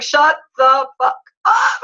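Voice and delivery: stunned disbelief. Retold or imitated. Woman reacting to a man's before photo, stunned disbelief. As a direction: draw it out like you can't believe your eyes.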